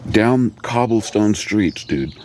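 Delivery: usual speaking voice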